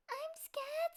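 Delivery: high small cub voice, scared; a tiny frightened cub; little bear cub. A narrator's reading.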